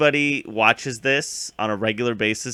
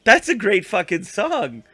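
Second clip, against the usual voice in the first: mock Joffrey; 6 semitones higher, 3 dB louder than usual. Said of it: "Joffrey's like".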